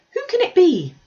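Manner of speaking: put-on high, loud eager girl's cry; excited girl. Kitty at the window to mamma, high and excited. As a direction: blurt it out like an excited young girl.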